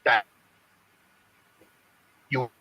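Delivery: ordinary talk, nothing marked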